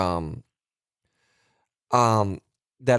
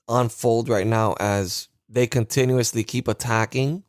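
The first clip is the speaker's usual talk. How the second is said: ordinary delivery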